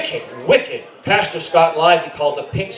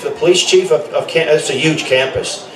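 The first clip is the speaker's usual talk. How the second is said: ordinary talk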